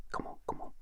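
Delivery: barely audible urging whisper; conspiratorial whisper. Retold or imitated. Teacher sneaking pupils out of class, urgent whisper. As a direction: whisper them out without getting caught.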